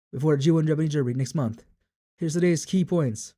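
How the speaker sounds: race announcer. Acted. Announcer introducing today's main race, projected. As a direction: present the big race to the crowd.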